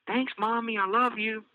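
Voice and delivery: put-on high, sweet child voice asking for a cookie; little girl Elise. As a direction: play a small girl begging sweetly.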